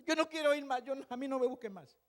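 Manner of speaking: complaining jar. Acted. Cracked jar refuses to work.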